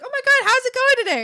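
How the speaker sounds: high, loud, fast gushing greeting; super happy. Retold or imitated. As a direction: imitate an overjoyed customer. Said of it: "super happy".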